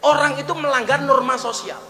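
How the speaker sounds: rousing pulpit preaching; fiery preaching. Acted. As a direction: preach it at full force to the crowd. Preaching at full force.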